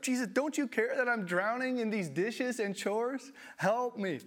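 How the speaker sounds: high, loud pleading whine; hammed-up desperate Martha; begging loudly for rescue; Martha overwhelmed. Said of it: "Now Martha comes up".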